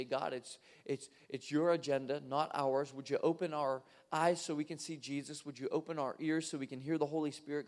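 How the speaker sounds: low, soft praying voice; prayer. Real, not acted. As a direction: lead a hushed, earnest prayer. Leads a prayer.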